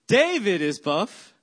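"David" hit hard on DA, slow and loud; stress on first syllable. Shown as a demonstration. Pastor showing congregation the syllable game, stress on DA of "David". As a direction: punch the DA in "David". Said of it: "you emphasize every syllable".

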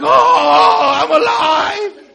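high, drawn-out shout of joy; hammed-up elated cry, very loud and slow; overjoyed George Bailey. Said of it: "he gets so excited".

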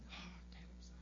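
mock whisper behind his back; gossipy whisper. Imitated gossip about the speaker.

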